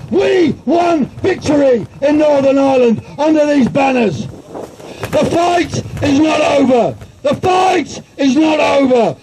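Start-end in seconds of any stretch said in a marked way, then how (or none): 4.3-7.0 slow, weighty declaration; measured, heavy statement before the shout; slow deliberate. Real, not acted. Repeated rally line, slow take. 7.2-9.2 shouted battle cry; rousing shouted repeat of the line; rally shout. Real, not acted.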